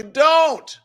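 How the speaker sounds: high, loud command; emphatic shout. Real, not acted. Telling sad Democrats to stop moping, emphatic shout. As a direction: shout it as one drawn order. Urging downcast viewers to stop.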